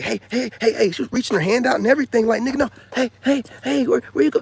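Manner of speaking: high, loud mocking call of a woman; mock yelled hey hey hey. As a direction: chase him with your voice. Imitating woman calling after him.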